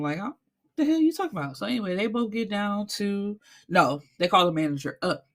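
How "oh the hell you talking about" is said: pitched-up disbelieving retort; mock indignation. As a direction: throw it back, high and incredulous. Retold indignant reply.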